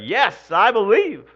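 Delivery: loud, high cry of yes I believe; pew shout. Pretend. Congregant jumping up in the pew, loud excited shout. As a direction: shout it out like a fired-up churchgoer. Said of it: "stand up in the pews and start".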